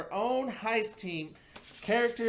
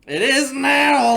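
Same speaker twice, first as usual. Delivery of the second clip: slow, loud cowboy drawl; Western drawl; 7 semitones higher, 12 dB louder, slower than usual. Acted. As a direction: drawl it out like a gunslinger. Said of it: "the Western drawl".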